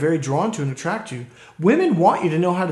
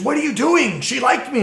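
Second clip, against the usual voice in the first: indignant client; 9 semitones higher, 4 dB louder than usual. Retold or imitated. Indignant client's retort retold.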